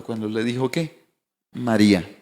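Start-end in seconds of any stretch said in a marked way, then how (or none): 1.5-2.1 lower, gentle call of her name; tender naming. Retold or imitated. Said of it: "¿Cuándo entendió el tono?".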